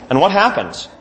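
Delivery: ordinary talk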